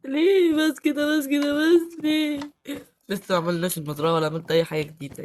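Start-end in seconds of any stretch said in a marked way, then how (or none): 0.1-2.4 high, slow fake whimper; hammed-up crying, drawn out; mock whimpering. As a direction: whine it out like a sulking child. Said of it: "بطل عياط".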